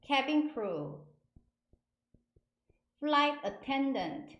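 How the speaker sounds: slow, careful model pronunciation with a falling end; slow word drill. Shown as a demonstration. Teacher leading learners in a read-along, slow word drill. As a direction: say each term slowly and separately for repeating. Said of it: "세 번씩 천천히".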